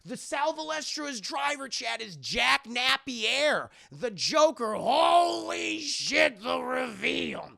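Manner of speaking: loud, high, hyped-up shouting; blown-away storyteller, drawing out the big words; excited reveal. Real, not acted. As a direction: shout the big twist in amazement. Storyteller hypes the big twist.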